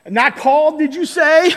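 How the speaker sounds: indignant Victorian preacher; William Booth. Retold or imitated. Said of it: "That's how I imagined him saying it".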